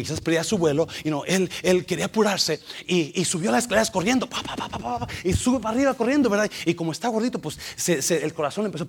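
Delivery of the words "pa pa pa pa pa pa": running footsteps. Retold or imitated. Imitates running up stairs.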